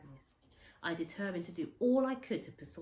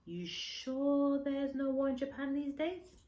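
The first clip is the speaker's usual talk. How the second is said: a lightly voiced old man asking, slow and higher; Kensuke asking; 9 semitones higher, 5 dB louder, slower than usual. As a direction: ask slowly and higher, in a hint of Kensuke's accent. Kensuke asks about Japan.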